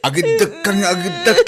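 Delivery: high, tearful pleading; crying plea. Acted. Wife crying as she begs her husband. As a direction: push the words out through tears.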